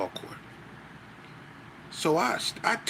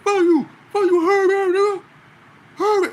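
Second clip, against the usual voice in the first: much higher and louder, a put-on strained shout; mocking a deaf man's yell; 11 dB louder than usual. Deaf inmate yelling back at the teller, high mock shout. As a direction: imitate the deaf guy cussing him out, loud and high. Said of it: "he sound weird".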